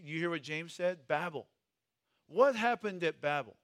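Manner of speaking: ordinary delivery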